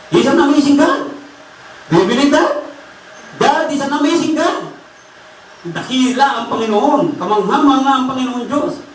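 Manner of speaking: high, ringing praise from the pulpit; exultant preaching. Acted. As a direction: stir the congregation to praise God. Exclaims praise to congregation. (masidhing pagpupuri sa pangangaral)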